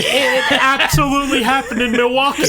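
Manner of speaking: laughing out loud while talking; bursting into laughter mid sentence. Real, not acted. Laughing at the Milwaukee joke.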